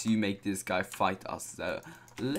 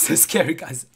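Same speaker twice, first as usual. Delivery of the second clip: usual speaking voice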